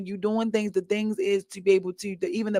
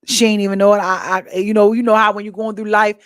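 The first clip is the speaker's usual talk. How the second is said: ordinary delivery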